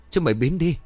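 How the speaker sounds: high, loud terrified scream; frightened man screaming at ghosts. A narrator's reading. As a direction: yell them away in terror. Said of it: "Quý lại hét lớn".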